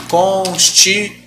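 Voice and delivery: Constitui said slowly, syllable by syllable. Shown as a demonstration. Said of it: "Olha esse ditongo". (Constitui, devagar, sílaba por sílaba)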